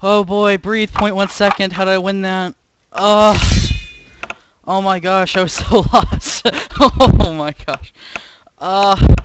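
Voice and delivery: breathless laughing disbelief; high, loud, gasping with joy; shrieking jackpot winner. Real, not acted. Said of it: "I can't breathe".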